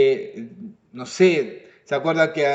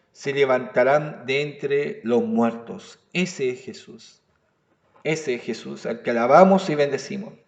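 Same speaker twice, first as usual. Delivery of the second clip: hushed reverent tone; 5 semitones lower, 5 dB quieter than usual. Real, not acted. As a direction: let it sink in quietly, with reverence. Hushed climax about Jesus.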